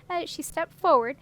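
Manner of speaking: plain reading voice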